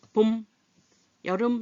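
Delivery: 봄 and 여름 as separate words, closed on ㅁ; isolated "봄", "여름", each cut off; cut off short. Shown as a demonstration. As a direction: stop each word on its final ㅁ: "봄. 여름.". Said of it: "이렇게 딱딱 끊어질 때".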